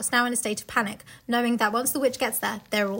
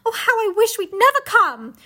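high, louder dramatic lament; hammed-up schoolgirl despair; despairing wish; 9 semitones higher, 6 dB louder than usual. Susan despairing.

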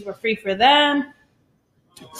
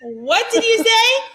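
indignant what; 11 semitones higher, 4 dB louder, slower than usual. Retold or imitated. Retold indignant comeback to husband.

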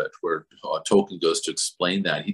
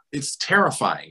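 ordinary delivery

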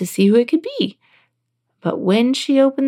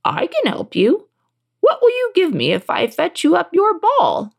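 higher-pitched storybook frog; 8 semitones higher than usual. A narrator's reading. Frog offers to fetch the ball, higher frog voice. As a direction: bargain as a talking frog, pitched up. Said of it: "answered the frog".

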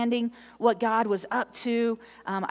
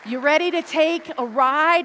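louder, rising each time, pumping up the room; rallying call; 7 semitones higher, 8 dB louder than usual. Real, not acted. Preacher to congregation, rousing rising calls. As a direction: build the excitement with every ask.

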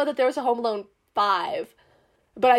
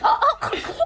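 laughing. Retold or imitated. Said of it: "And I'm like".

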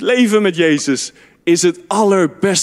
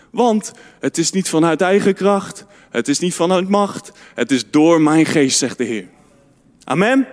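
ordinary talk, nothing marked

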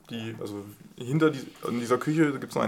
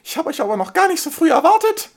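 very high, fast lisping little dragon; squeaky lisping dragon voice, flustered host; slushy lisp; 8 dB louder than usual. Acted. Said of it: "mit lispelnder Stimme zu euch spricht".

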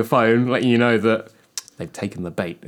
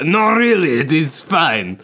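put-on French accent; 4 semitones higher, slower than usual. Robot spider voice bit.

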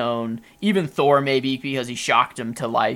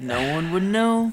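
higher hammed-up delivery; mock dramatic line; 7 semitones higher than usual. Friends trade a higher hammed-up movie line. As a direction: ham it up.

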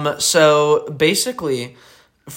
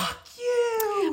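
very high, softer squeaky mimicry; mock outburst; 5 dB quieter than usual. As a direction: squeal the insult in a tiny, high voice. Mimicking their past fight outburst.

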